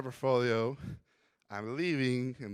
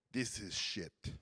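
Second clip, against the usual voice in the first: gruff teacher; 6 semitones lower, 9 dB quieter, slower than usual. Retold or imitated. Teacher's harsh verdict retold.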